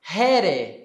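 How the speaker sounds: ordinary talk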